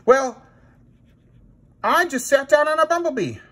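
very high little princess puppet. Acted. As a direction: explain like a silly princess puppet. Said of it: "Sally the princess".

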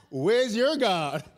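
drawn-out, cocky challenge; cocky taunt. Acted. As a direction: taunt them slowly, sure of yourself. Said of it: "he's getting cocky".

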